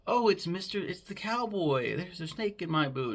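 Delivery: high, excited little-girl voice, mocking; little girl Bonnie. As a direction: squeak it out like a kid playing with a cowboy toy. Imitating little Bonnie playing.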